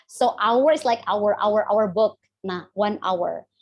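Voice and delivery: normal talking voice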